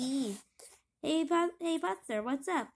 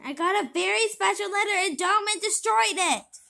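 upset; 6 semitones higher, 8 dB louder than usual. Acted. Upset chief complains.

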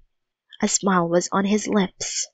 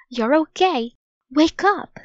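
urgent; 7 semitones higher than usual. Acted. Annie waking dreaming boy.

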